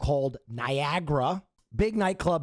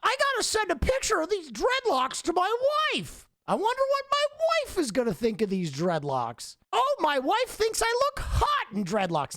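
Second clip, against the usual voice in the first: high-pitched mocking impression of a wife-obsessed husband; mock an over-eager husband, pitched way up; Ned the wife guy; 13 semitones higher than usual. YouTuber mocking the guy who always mentions his wife, high giddy mock voice. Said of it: "He's always the guy who's gonna be like".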